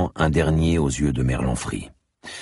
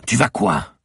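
higher, cutting challenge; challenging; 9 semitones higher, 3 dB louder, slower than usual. A narrator's reading. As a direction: cut off his threat with a dare. Commissaire cuts off the threat. (défi lancé d'un ton plus haut)

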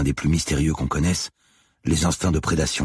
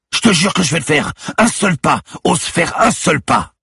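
loud, high-pitched shouted dare; yelling, daring him to move; yelled threat; 8 dB louder than usual. A narrator's reading. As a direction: shout a warning at a suspect held at gunpoint. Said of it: "hurla Lucie en le braquant".

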